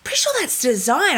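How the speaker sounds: high, a bit squeaky, dismissive; mocking boyfriend. Boyfriend insisting the cobwebs are design, mildly squeaky. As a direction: lightly squeak out his excuse. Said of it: "He's like".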